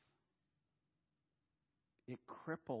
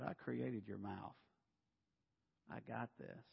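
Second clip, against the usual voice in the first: calm God; 6 dB quieter than usual. Acted. God answers Moses calmly.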